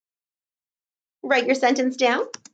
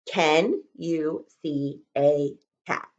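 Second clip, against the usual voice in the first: slow dictation; 5 semitones lower, 4 dB quieter, slower than usual. Shown as a demonstration. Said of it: "One more time".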